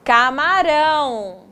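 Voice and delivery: the word joined up, drawn out at the end; whole word, slowly. Shown as a demonstration. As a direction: say it slowly in one piece. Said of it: "separamos essas palavras em sílabas".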